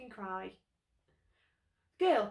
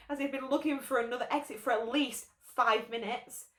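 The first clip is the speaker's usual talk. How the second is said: usual speaking voice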